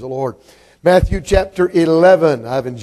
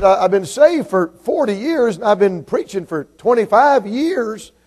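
earnest protest; 6 semitones higher, slower than usual. Acted. Pastor told by a boy to cuss, high protesting. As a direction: plead your long record, voice climbing high.

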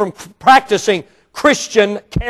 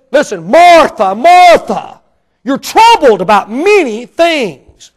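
higher, louder, slow exasperated rebuke; slowed, pointed rebuke of Martha; aggravated Jesus; 5 semitones higher, 7 dB louder, slower than usual. Acted. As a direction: scold her with a touch of aggravation. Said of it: "a little aggravation".

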